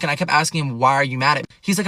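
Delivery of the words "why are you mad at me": usual speaking voice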